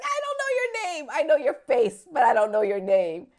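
starts very high, comes down loud; insistent friend imitation. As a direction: act out his frantic insisting. Friend insists he forgot her name.

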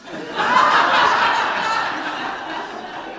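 breathy unvoiced laughter; real laugh. Real, not acted. Preacher laughing at his friend's answer. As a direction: crack up at the memory. Speaker cracks up retelling.

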